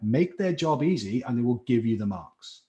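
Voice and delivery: ordinary talk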